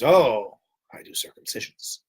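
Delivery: hushed, unvoiced little confession; mock rabbi whisper. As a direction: whisper it like a sly secret. Rabbi character in film retold.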